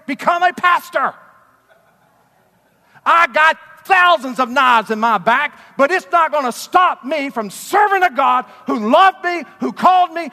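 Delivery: shouted, high, impassioned preaching; preaching at full force. Real, not acted. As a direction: preach it loud and high, giving everything.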